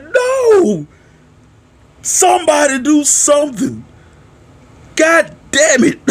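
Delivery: very high, mock-panicked cry; retold desperate plea. Fan begging someone to step in, mock desperate plea.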